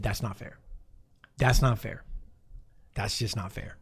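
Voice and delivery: low, soft self-pitying moan; a quiet pouting mutter; whiny pity party. Retold or imitated. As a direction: sulk it to yourself quietly. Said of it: "You've said".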